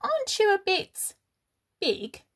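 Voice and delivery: high, doubting question; high curious voice; suspicious little bee. Acted. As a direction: poke at him like a clever know-it-all. Clever bee questions Norman.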